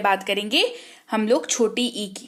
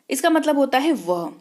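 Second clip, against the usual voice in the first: ordinary talk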